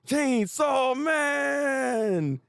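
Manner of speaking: high, long-held shout of a name; drawn-out call. Pretend. Calling him together, long drawn-out high shout. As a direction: stretch each word out, calling high.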